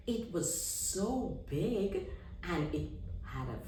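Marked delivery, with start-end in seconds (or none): 0.1-2.1 hushed, slow awe; awed gasp. A narrator's reading. Narrator describing the huge light, slow hushed awe. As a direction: breathe it out in wonder.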